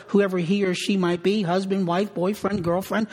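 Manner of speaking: normal talking voice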